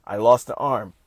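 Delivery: low, lifeless robot voice; robotic. Acted. Mocking someone who shrugs off losing an arm, robotic monotone. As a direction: deliver it like a machine that feels nothing. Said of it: "robotic now".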